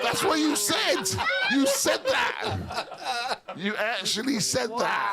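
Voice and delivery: high, slow, laughing insistence; gleeful calling out; laughing accusation. Real, not acted. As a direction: shout it through laughter, delighted. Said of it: "laughing accusation at the guest".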